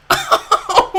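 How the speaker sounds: shrill breathy laugh; high giggle. Real, not acted. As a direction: squeal with laughter. Laughing after win.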